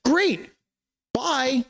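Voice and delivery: breezy and phony, like a smiling thief; mock cheerful official. Host mocking state officials seizing a family factory, high and falsely cheery.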